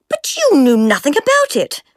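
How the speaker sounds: plain reading voice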